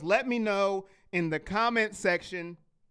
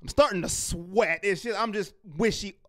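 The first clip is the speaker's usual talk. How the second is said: ordinary talk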